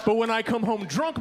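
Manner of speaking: ordinary talk, nothing marked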